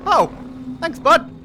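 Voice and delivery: high, loud chirpy thanks; bright shout of thanks; cheerful thanks. Real, not acted.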